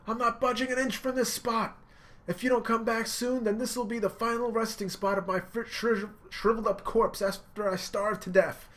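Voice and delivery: high, loud emotional yelling; impassioned shouting. Retold or imitated. Luffy yelling that Sanji is lying. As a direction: call him out, all heart.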